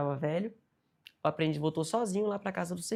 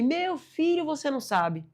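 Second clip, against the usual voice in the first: put-on much higher, louder eager old man; 10 semitones higher, 5 dB louder, slower than usual. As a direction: burst out like an excited old man. Old man revealing news. (senhorzinho empolgado contando novidade)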